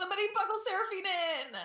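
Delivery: high, loud and fast shout; panicked shout. Acted. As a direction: yell it in a panic.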